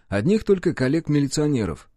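ordinary delivery

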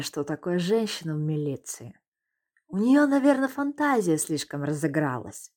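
mock-sorry, condescending; fake sympathy. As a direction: sound falsely sympathetic, talking down. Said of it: "с наигранным сочувствием обратился".

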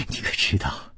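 soft, breathless giggle through the words; giggling. A narrator's reading. Gong Nanyan giggling at her lover, teasing. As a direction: laugh dreamily as you ask. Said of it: "忽然痴痴地笑起来".

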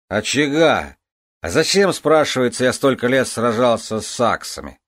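put-on loud, slurred, rising drunk rant; a tipsy king holding forth. Drunk king ranting loudly. (пьяная громкая тирада)

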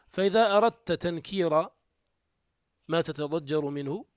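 ordinary talk

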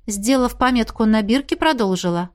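ordinary delivery

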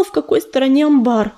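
ordinary delivery

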